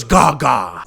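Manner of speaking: lightly mocking actor imitation. Reviewer imitating how the actor says a character's name, mock impression. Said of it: "Like, that's how he talks".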